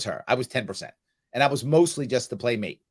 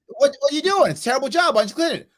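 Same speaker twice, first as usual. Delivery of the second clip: high, louder teasing scold; mock scolding; 12 semitones higher, 4 dB louder, faster than usual. Dad to kid over a badly cleaned table, mock scolding. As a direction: play the exasperated dad for laughs. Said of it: "I go".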